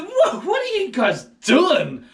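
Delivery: a father facing the angry mob; surprised dad. Retold or imitated. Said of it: "Gideon's dad came and said".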